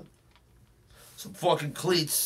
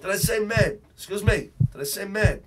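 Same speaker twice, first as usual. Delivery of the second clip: higher, louder, offended retort; indignant outburst; 7 semitones higher, 4 dB louder than usual. Acted. Tough guy correcting the interviewer who called him mad, indignant. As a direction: jump on the word like it insulted you.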